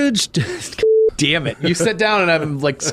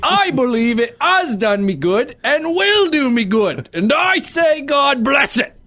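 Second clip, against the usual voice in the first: put-on a touch louder, hammy old miser; hammed-up Scrooge; 6 semitones higher, 3 dB louder than usual. Reading Scrooge's reformed speech, hammed-up and raised. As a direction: declaim like a stage Scrooge.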